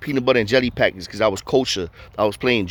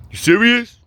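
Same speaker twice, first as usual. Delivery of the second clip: high, loud disbelief; can't believe the offer; surprised; 10 semitones higher, 5 dB louder than usual. Retold or imitated. The neighbor reacting to the offer, surprised and high.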